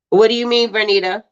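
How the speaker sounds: usual speaking voice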